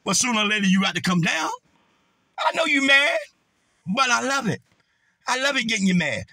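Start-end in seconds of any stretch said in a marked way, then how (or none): 2.4-6.2 a gleeful dig at the rival; gloating taunt. Real, not acted. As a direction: rub it in with delight.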